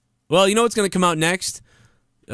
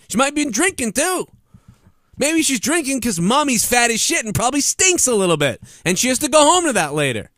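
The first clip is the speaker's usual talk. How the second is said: raised, heated rant at blaming parents; furious, pitched-up tirade; angry rant; 3 semitones higher than usual. Real, not acted. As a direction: rant hard and high, spitting the insults. Ranting at parents who blame games.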